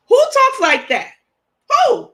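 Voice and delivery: high, loud, appalled cry of disbelief; outraged disbelief. Real, not acted. Vlogger outraged at a rude message, loud disbelieving cry. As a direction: shout it out, stunned by the insult.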